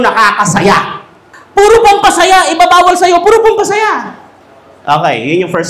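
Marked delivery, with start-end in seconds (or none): 1.5-4.2 loud, high-pitched exclamation; mock protest. Speaker hammers home what doctors forbid, mock protest. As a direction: pitch jumps right up, loud and insistent.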